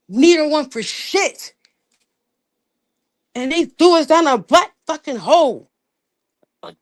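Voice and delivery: rally the viewers like a preacher; full-force live-stream preaching. Acted.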